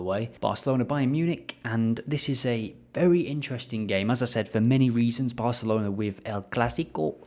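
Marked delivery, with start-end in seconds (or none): 6.2-7.1 El Clásico with a botched Spanish accent, ending on a pure Spanish o; failed Spanish accent. Shown as a demonstration. Vlogger previewing Barcelona attempts Spanish accent, botched. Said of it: "That sounded Japanese, not Spanish".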